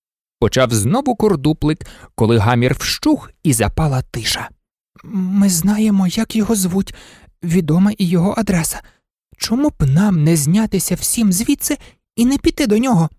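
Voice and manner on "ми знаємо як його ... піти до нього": high-pitched, hesitant little dog voice; timid toy dog. Acted. Toy dog airing his thought to the shop-window toys, timid high voice. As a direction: speak up shyly, high and haltingly.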